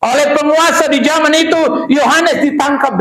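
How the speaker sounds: fiery sermon shout; preaching at full force. Acted. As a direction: rouse the congregation from the pulpit.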